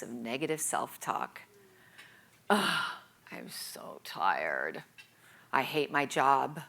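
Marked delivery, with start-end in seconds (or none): 2.5-4.8 low, slow, drained groan; weary groan. Retold or imitated. Tired woman moaning to herself in bed, low and slow. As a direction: drag it out like you can't face the day. Voicing her morning self-talk.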